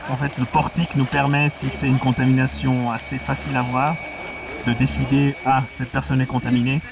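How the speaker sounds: muffled voice behind a mask; through a gas mask. Real, not acted. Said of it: "On vous entend respirer".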